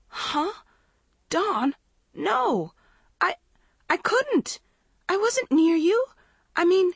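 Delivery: sputtering denial in shock; horrified sputter. A narrator's reading. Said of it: "Maggie started to sputter".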